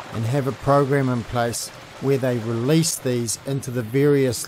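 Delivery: low, measured travel-show narration; documentary voiceover. Acted. Host telling viewers about the hatchery visit, measured voiceover. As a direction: guide viewers through the trip in an even read.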